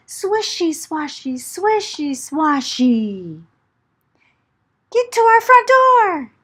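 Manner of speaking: ordinary delivery, nothing marked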